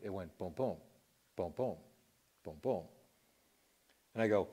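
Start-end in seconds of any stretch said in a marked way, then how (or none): 0.4-2.8 soft, even booms; a hushed, regular pulse; quiet, awed, steady heartbeat booms; normal heartbeat sounds. Shown as a demonstration. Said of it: "I felt a normal heartbeat".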